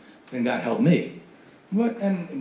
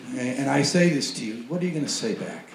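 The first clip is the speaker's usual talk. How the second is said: normal talking voice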